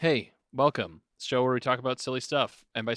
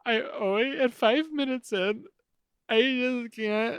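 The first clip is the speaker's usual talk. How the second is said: high, slow mock tearful voice; mock emotional; 11 semitones higher than usual. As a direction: fake choking up with feeling. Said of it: "Dars gets emotional right around this point".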